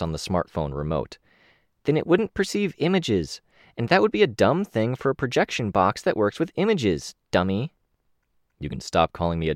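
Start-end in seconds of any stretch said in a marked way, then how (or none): 1.8-7.7 higher, patient know-it-all; patient Kenzie voice. Acted. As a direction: explain it patiently, a bit smug.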